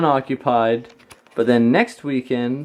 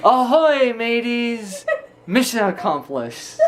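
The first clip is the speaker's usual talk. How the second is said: drowsy mock pirate mumble; lightly voiced sleepy pirate; sleep talking; 7 semitones higher, slower than usual. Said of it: "she's laying there and I just start hearing something mumble".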